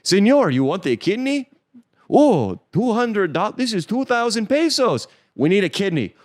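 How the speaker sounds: high, loud mock Mexican accent; hammed-up Mexican street seller. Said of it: "this is what would happen".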